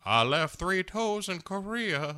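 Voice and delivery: put-on high, drawling country old-timer; mock Southern veteran; Uncle Jesse. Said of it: "Uncle Jesse says".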